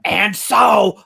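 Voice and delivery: high, loud, slow impression attempt; Gilbert Gottfried. Giving up on a hard voice, high drawn-out mimicry. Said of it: "I can't do that one".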